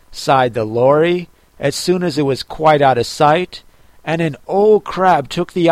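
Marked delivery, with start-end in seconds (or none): none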